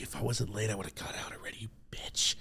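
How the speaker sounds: hushed whisper under the breath; a secret, furious whisper from the backseat; whispered curse. Retold or imitated. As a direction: whisper it so the driver can't hear. Cursing the driver under breath.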